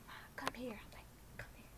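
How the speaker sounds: urgent whispered call; whispered call to her mom across the yard. Retold or imitated. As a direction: hiss it so no one else hears. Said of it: "signaling my mom".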